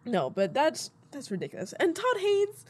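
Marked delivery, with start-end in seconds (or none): 1.8-2.6 incredulous. Real, not acted. Incredulous at a ridiculous claim.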